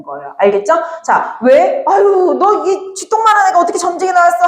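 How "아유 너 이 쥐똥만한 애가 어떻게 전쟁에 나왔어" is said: higher, teasing mock taunt; teasing jeer. Soldiers mocking the tiny soldier's size, teasing. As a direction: poke fun at his size. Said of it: "외형은 같지만".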